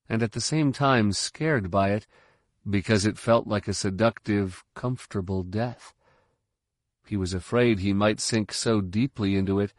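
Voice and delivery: plain reading voice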